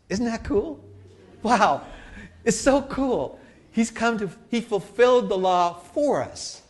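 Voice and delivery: high, giddy amazement with a laugh; real delighted wonder. Real, not acted. Preacher marveling at Jesus fulfilling the law, delighted wonder.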